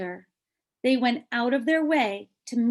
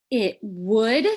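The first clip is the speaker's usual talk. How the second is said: surprised; 7 dB louder than usual. Acted. Child surprised.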